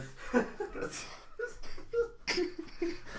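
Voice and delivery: high, breathless laughing; helpless giggling fit; breaking into laughter. Real, not acted. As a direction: crack up as the sketch falls apart. Sketch collapses into laughter.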